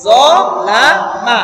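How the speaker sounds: very slow, each syllable drawn out; broken into syllables. Shown as a demonstration. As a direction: sound it out piece by piece. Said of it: "ভেঙে ভেঙে".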